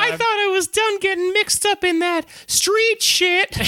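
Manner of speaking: high, slow squeaky tough-guy elf; tiny bug playing street hard; little elf voice. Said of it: "a little elf voice".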